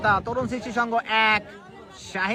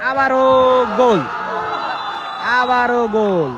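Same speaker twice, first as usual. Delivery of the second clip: goal call; 4 semitones higher, 8 dB louder, slower than usual. Real, not acted. Third goal called.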